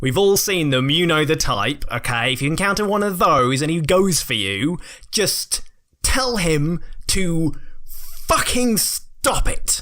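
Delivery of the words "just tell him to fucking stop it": slow, deliberate command; authoritative. Pretend. Telling listeners how to stop an attacker, slow and authoritative. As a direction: spell it out slowly, with confidence. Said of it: "if you sound authoritative enough".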